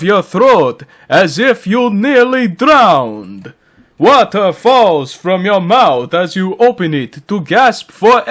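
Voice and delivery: high, swooping vampire count narration; Dracula. Pretend. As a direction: read it as a hammy, theatrical vampire. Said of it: "read it in the Dracula voice".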